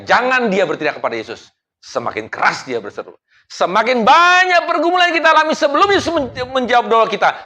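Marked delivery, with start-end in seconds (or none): 3.5-7.5 high, loud push; preaching burst. Acted. Pastor exhorting the church to pray harder, loud. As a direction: lift the line to a peak.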